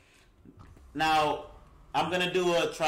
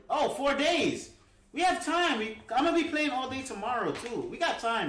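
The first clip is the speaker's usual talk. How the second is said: excited; 9 semitones higher than usual. Real, not acted. Excited about four days left.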